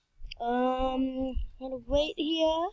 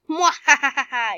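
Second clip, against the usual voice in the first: evil laugh; 8 dB louder than usual. Pretend. Evil laugh after threat.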